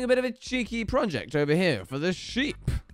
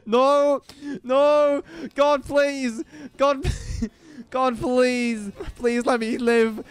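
higher, louder desperate begging; pleading; 5 semitones higher, 4 dB louder, much slower than usual. Real, not acted. Player begging God to let him live, pleading. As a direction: plead for mercy for real.

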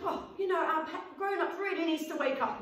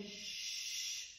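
a soft shushing hiss; 16 dB quieter than usual. Shown as a demonstration. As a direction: shh like hushing a sleeper. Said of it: "as if we're saying".

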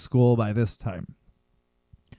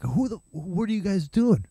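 cop voice; 5 semitones higher, 3 dB quieter than usual. Retold or imitated. Cop voice in retold arrest.

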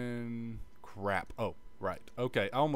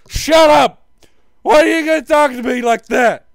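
yelling much higher and louder; mock angry shout; 21 dB louder than usual. Gamer yelling at his own insult, furious mock outburst. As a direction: snap back at an imagined heckler.

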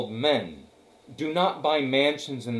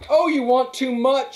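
high, loud cry of protest; 11 semitones higher, 7 dB louder than usual. A narrator's reading. Daisy crying out to Gatsby, high and loud. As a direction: throw the line out high and loud. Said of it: "she cried to Gatsby".

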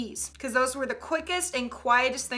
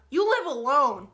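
ordinary talk

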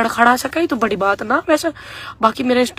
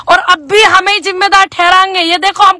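mock wail; 7 semitones higher, 5 dB louder than usual. Mock lament at blame.

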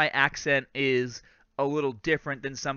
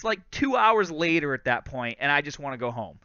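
ordinary delivery

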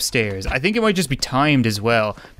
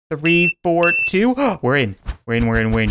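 normal talking voice

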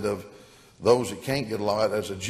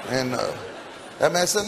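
ordinary delivery

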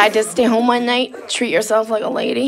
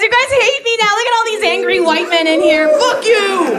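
mock defiant yelling; 9 semitones higher than usual. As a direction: yell back at the angry men. Yelling back at angry men.

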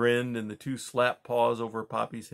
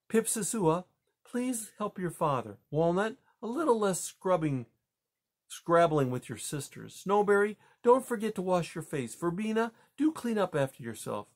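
higher, gentle motherly voice; a mother fussing over her children one last time; tender maternal send-off, higher pitched; fond mother; 7 semitones higher than usual. A narrator's reading. Mother's parting advice to children.

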